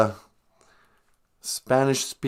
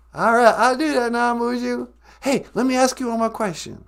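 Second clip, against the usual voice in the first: much higher, louder silly puppet voice; 13 semitones higher, 4 dB louder than usual. Acted. Puppet asking the host one more question, high silly voice. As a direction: squeak it out like a cheeky puppet.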